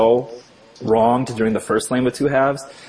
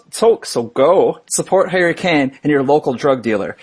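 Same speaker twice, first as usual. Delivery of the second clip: ordinary talk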